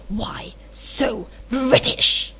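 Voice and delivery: slow, breathy whispered villain punchline; Bakura whisper. Acted. Delivering the villain's punchline to viewers, slow Bakura whisper. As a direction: whisper the punchline slowly and menacingly. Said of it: "I wonder if that actually sounded like Bakura".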